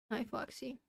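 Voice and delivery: very soft, cooing to the cat. Real, not acted. Whispered baby talk to cat.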